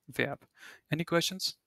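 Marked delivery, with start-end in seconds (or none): 0.1-0.4 softly repeated "verb" in the German way, open e; German pronunciation of Verb. Shown as a demonstration. Teacher checks the class follows, "verb" softly pronounced the German way. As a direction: repeat "verb" quietly as German Verb, roughly "vairb". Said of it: "but you pronounce it like verb".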